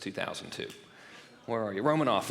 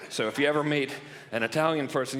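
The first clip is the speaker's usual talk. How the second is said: ordinary delivery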